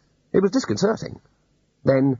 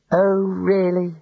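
weary; 7 semitones higher, much slower than usual. Acted. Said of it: "he said wearily".

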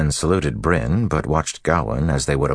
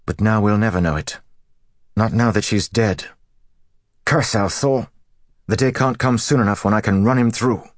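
higher-pitched, heated outburst; bitter grief turning to fury; rising anger; 7 semitones higher, 4 dB louder than usual. A narrator's reading. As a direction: vow revenge with mounting anger. Felt the heat of anger rise.

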